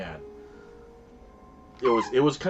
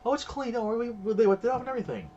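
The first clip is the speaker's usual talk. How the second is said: much higher, fast, breezy reassurance; Wheezy's voice; 3 dB louder, faster than usual. Retold or imitated. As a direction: play her as a chirpy, unbothered lady. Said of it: "so she's like".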